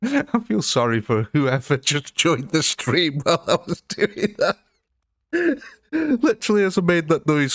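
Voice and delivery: high-pitched, helpless amusement; laughing. Real, not acted. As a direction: talk through your own giggles. Cracking up after own silly noise.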